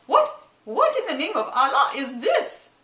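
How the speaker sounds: outraged husband in a hammed-up Middle Eastern accent; put-on Middle Eastern accent. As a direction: blurt it out in a bad Mid-Eastern accent. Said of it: "excuse the accent".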